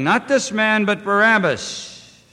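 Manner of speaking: crowd crying out. A narrator's reading. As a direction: cry it out like a crowd's demand. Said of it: "Then cried they all".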